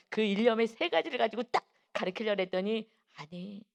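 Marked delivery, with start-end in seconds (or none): 3.1-3.6 very soft, low-key interjection; gentle correction. Retold or imitated. Holy Spirit correcting the teacher, very soft voice. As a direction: hush it right down.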